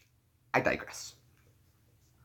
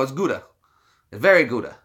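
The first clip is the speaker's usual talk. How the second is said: ordinary talk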